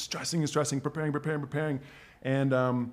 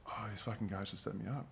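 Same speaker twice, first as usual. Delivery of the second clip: muttered to himself; 4 semitones lower, 14 dB quieter than usual. Retold or imitated. Retold inner thought, frozen.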